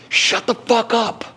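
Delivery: slow, high put-on voice for her; blunt teacher. Breathwork teacher silencing him, slow and blunt. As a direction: deliver her rebuke slowly, in character. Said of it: "And she goes".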